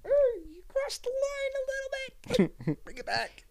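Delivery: squeaky high mock scolding. Friend teasing friend in a squeaky high voice. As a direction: pitch way up like a whiny friend.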